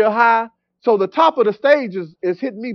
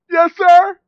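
higher, imitating a crying answer; mock tearful; 7 semitones higher, 4 dB louder than usual. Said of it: "So I'm, I'm crying".

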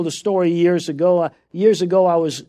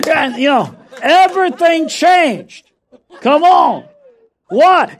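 louder, rising preacher's cry; preaching at full force; 7 semitones higher, 5 dB louder than usual. Acted. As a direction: drive it home to the church.

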